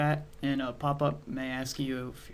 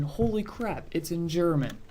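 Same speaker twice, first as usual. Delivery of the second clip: higher, startled exclamation; surprised; 4 semitones higher than usual. Real, not acted. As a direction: caught off guard by German text. The page is in German.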